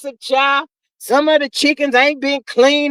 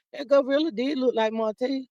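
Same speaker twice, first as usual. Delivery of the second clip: mild quiet aside; 10 dB quieter than usual. Real, not acted. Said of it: "repeated line".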